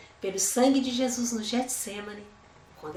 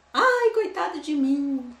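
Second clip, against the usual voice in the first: mock self-pity; 5 semitones higher, 6 dB louder, slower than usual. Mocking self-pitying believer.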